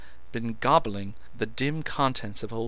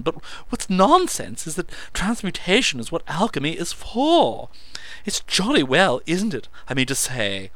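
excited; 9 semitones higher, 9 dB louder than usual. A narrator's reading. Meadows babbling excitedly.

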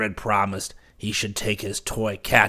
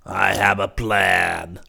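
slow, sly villain king; wicked king; 4 dB louder, much slower than usual. Acted. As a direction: savour the plan, slowly and slyly. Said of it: "replied the wicked king".

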